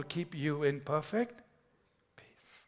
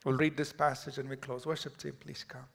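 ordinary delivery

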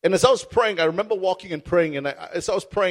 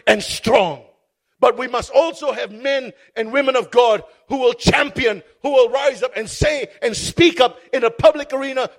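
raised, ringing sermon voice; rally the congregation to courage; full-force pulpit exhortation; fiery preaching; 3 semitones higher, 4 dB louder than usual. Acted.

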